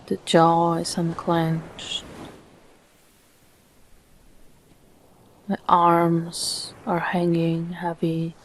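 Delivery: calm meditation voice with long, unhurried pauses; soothing, evenly paced relaxation guidance; guided meditation. Acted. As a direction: guide the listener gently into stillness, phrase by phrase.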